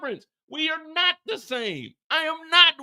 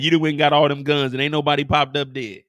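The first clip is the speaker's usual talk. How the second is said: mock recital; 10 semitones lower, 5 dB louder, faster than usual. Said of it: "Who said that?".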